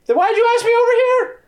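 very high, put-out challenge; high indignant rider. Acted. Rider turning the question on the party, high and indignant. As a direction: throw it back, way up high.

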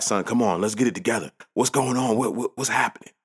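a hint of sideline coach urging his son to pull it together; coach dad. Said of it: "I'm being coach dad".

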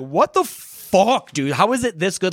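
high, slow, drawn-out amazement; stunned by the show; awed disbelief. Retold or imitated. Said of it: "I'm like".

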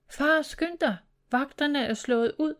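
high-pitched, urgent cry to father; urgent call. A narrator's reading. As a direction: call out the alarm, without full volume. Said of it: "råbte han".

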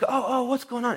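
high-pitched, flustered cry; distressed wife. Retold or imitated. Wife finding her husband fallen, high distressed voice. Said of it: "oh, she's like".

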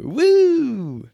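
sarcastic high whoop; a fake party whoop; mock cheer.

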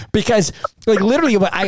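normal talking voice

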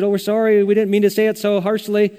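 high, loud rush of apology; hasty apology. Retold or imitated. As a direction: kids backpedalling after upsetting mom. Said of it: "she just started crying".

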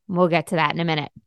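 ordinary delivery

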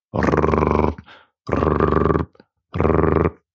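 long rolled R as a drill; low, steady drilling rrrr; imitated drill noise. A narrator's reading. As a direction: trill the tongue like a power drill. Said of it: "zette de boor tegen de muur aan".

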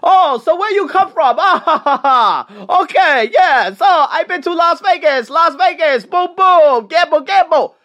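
high, loud, sugary schmoozing with fake laughs; butter up the passenger as a cabbie who won't turn on the meter; overly nice taxi driver. Taxi driver sweet-talking a foreign passenger, high and loud mock-friendly. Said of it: "he starts acting very nice to you".

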